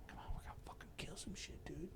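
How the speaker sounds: hushed conspiratorial whisper; whispered cat. Retold or imitated. The cat urging him on, a sneaky whisper. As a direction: whisper like a soldier on patrol.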